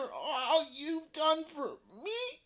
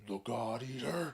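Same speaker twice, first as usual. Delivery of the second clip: very low, hushed dragon; low, quiet dragon voice; 14 semitones lower, 5 dB quieter than usual. Acted. Dragon fearful recognition.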